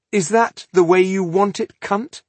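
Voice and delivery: high, loud angry challenge. Acted. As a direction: hurl it back in anger. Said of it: "stony-faced with anger".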